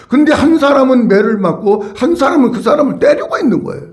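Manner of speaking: ordinary talk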